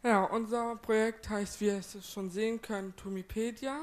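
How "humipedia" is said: ordinary talk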